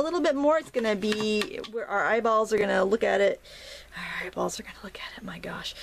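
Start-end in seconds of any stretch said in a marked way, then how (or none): 4.0-5.7 amused whisper to herself, much softer; whispered aside. Real, not acted. Painter whispering an aside about eyeballs to herself. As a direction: hush it like a private joke.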